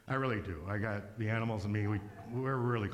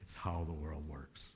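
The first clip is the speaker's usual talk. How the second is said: whisper; 7 semitones lower, 6 dB quieter than usual. Acted. Hawk consoles him.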